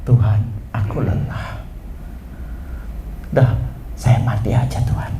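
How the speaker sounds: low, soft, worn-out plea; weary prayer. Acted. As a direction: spent prophet confiding in God. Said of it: "Kalau saya mau narasikan percakapannya dengan Tuhan".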